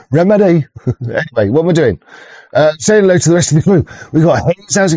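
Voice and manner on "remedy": REMEDY higher, read as it is misspelt; phonetic spelling read out. Retold or imitated. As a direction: say Remedy as if sounding out odd phonetics. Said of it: "writing it out phonetically".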